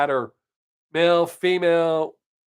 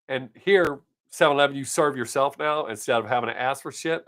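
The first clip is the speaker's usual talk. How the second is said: ordinary talk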